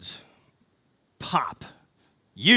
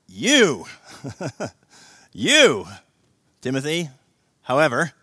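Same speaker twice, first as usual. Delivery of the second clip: YOU punched loud; emphatic you; 3 semitones higher, 12 dB louder, slower than usual. Shown as a demonstration. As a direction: hit You hard, then Timothy. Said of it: "given an emphatic position".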